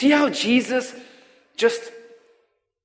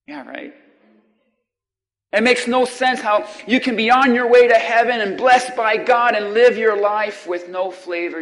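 ordinary delivery

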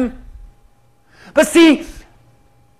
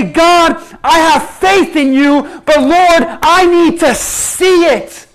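pleading prayer; 5 semitones higher, 6 dB louder, slower than usual. Acted. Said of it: "we say".

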